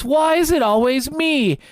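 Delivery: high, whiny mock cry of a picked-on kid; whiny Neville. Retelling a film scene, imitating a high whiny schoolboy. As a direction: whimper it like the bullied boy. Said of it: "he goes".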